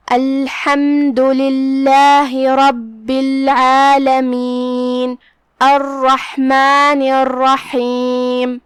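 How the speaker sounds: drawn-out, melodic tajweed recitation; measured chanting of scripture; slow Quran recitation. Shown as a demonstration. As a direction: recite each word slowly, with tajweed. Demonstrates the recited verses.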